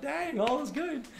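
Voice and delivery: high, drawn-out gleeful exclamation; hammed-up high-pitched contentment; mock delight. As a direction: squeal it slowly, overjoyed. Retells delight at fifty dollars.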